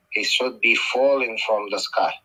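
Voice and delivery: usual speaking voice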